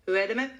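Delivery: "Hur är det med" merged into one short unit; quick unstressed "Hur är det med"; monotone, as one word. Shown as a demonstration. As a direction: say "Hur är det med" evenly, no stress, left hanging. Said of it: "very monotonous".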